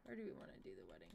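hushed unvoiced whisper; quick hushed whisper. Real, not acted. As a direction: whisper the plan as if in secret. Quiet planning over wedding scene.

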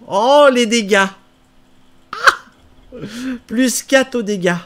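excited. Real, not acted. Excited by weapon damage.

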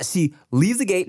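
ordinary talk, nothing marked

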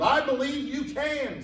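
high, eager profession of belief; excited believer. Retold or imitated. Front-row man to tightrope walker, excitedly. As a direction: call it out like an excited spectator. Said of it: "was so excited".

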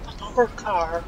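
high, soft mock-Irish lament; hammed-up Irish keening, pitched high; old Irish person. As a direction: whimper it in a put-on old Irish accent. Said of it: "Sound like an old Irish person".

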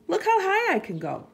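high, loud, quick showing off; excited boast. A narrator's reading. Sheep shows off its jump.

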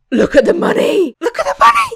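squealing with delight over the money; high, loud, thrilled cry; excited. Real, not acted.

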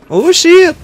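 sudden high startled cry; startled yelp. Real, not acted. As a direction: jolt up in sudden alarm. Sudden danger in a firefight.

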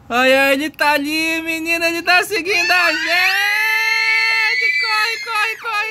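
being chased. Pretend. Monster following them in game.

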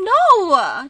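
quick loud protest. Real, not acted. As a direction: shout no in mock horror. Asked about kissing him.

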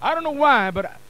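ordinary delivery

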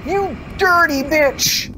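high, stretched-out outraged yell; real jump-scare rage; startled curse. Real, not acted. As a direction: swear at what just killed you. Jump scare, cursing the monster.

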